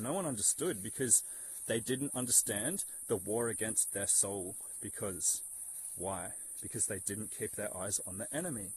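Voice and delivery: ordinary delivery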